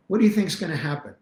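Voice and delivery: ordinary delivery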